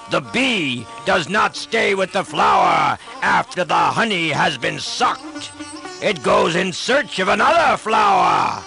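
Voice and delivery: furious demon king roaring at his brother; enraged king denouncing a traitor brother; raging shout. Acted. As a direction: bellow with contempt, a scornful laugh mid-rant. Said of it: "and he shouted".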